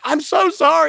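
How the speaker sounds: put-on high, sorry little-girl voice; imitating his guilty daughter, high; apologetic daughter. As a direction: mimic a contrite child. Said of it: "she's like".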